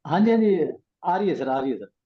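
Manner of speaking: ordinary talk, nothing marked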